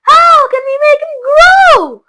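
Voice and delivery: high, loud, slow plaintive plea; wailing. A narrator's reading. Mother wails to Wonka for help. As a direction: beg for help. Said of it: "wailed Missus T V".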